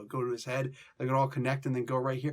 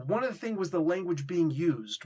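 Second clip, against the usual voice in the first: usual speaking voice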